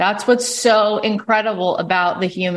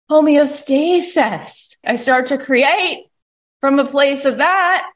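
ordinary delivery

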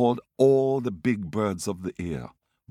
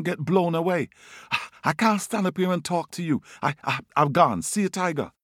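higher, hurried warning; fake panic; 7 semitones higher than usual. Brer Rabbit tricking Tiger with a higher, hurried panicky warning. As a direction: sell the fake storm in a rush.

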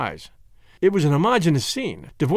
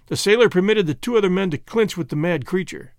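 ordinary delivery, nothing marked

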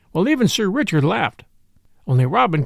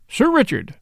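higher-pitched mild reproach; reproachful; 7 semitones higher than usual. A narrator's reading. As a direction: call him to account reproachfully. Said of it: "in a reproachful tone".

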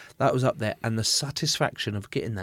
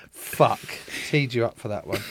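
ordinary talk, nothing marked

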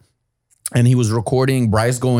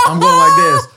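normal talking voice

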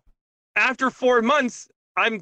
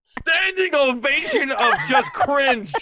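laughing through the words; giggling as she says it; 6 semitones higher, slower than usual. Real, not acted. To the group, laughing at her own joke.

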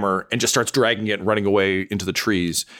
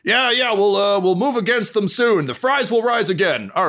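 troll yelling back; 11 semitones higher than usual. Acted. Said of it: "he just yells back over his shoulder".

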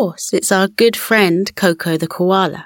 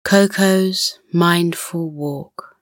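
guided relaxation. Acted. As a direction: gently settle a child to sleep.

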